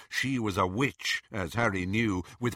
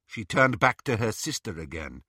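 ordinary delivery, nothing marked

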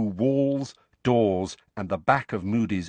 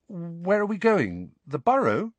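high, hopeful question; 9 semitones higher than usual. A narrator's reading. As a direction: lift the voice with eager hope. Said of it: "Harry asked hopefully".

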